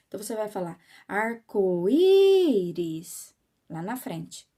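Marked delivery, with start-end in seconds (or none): 1.1-2.6 loud, drawn-out call with a long í. Shown as a demonstration. Calling someone from outside their house because the bell is broken, calling out. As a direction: call "Arco-íris" out, stretching the stressed í. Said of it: "então você vai ter que chamar".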